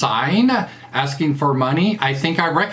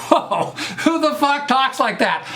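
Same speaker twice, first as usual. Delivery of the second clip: laughing disbelief; 10 semitones higher than usual. Real, not acted. Reacting to a silly text.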